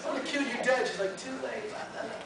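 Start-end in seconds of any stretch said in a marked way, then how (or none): 0.1-0.9 high-pitched comic threat; hammed-up fury, pitched way up; mock rage. Comic character choking his rival, mock-furious. 1.2-2.2 high, softer teasing; taunting. Acted. Zombie child taunting the one choking her, teasing. Lenore taunts him once more.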